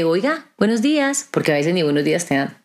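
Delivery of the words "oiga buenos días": bright high cheerful greeting; smiling greeting. Retold or imitated. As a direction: greet a grumpy clerk with a smile. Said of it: "dale una sonrisa". (saludo alegre y agudo con sonrisa)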